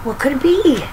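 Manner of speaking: ordinary delivery, nothing marked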